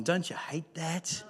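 ordinary delivery

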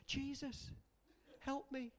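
half-hearted mock prayer, soft and lifeless; insipid prayer. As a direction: mumble it like you barely care. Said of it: "like some insipid Christians".

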